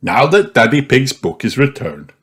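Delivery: plain reading voice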